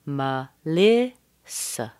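"Melissa" slow, middle li stressed; weak me, strong li, weak sa. Shown as a demonstration. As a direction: drag it out, hit the li. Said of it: "Schwa, primary stress, schwa".

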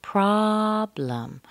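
PROBLEM with a long, high first syllable; two clear syllables. Shown as a demonstration. Teacher repeats the two syllables, stressing the first of PROBLEM. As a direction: stretch PROB high, drop on LEM in "problem". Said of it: "two syllables".

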